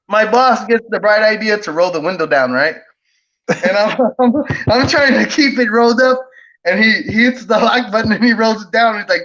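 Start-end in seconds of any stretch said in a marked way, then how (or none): none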